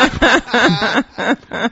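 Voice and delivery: real giggling burst; high giggle. Real, not acted. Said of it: "now they got the giggles".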